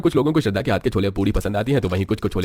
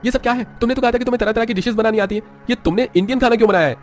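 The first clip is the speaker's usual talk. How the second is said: annoyed demand; 12 semitones higher than usual. Acted. Husband upset at Indian food.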